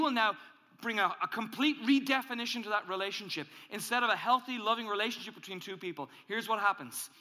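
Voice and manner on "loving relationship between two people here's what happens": ordinary talk, nothing marked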